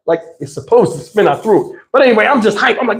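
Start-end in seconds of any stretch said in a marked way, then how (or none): none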